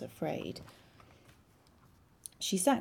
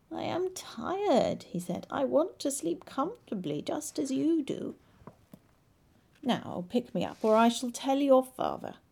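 whiny frog voice; 6 semitones higher than usual. Acted. Frog demands to sleep.

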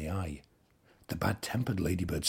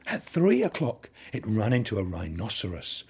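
plain reading voice

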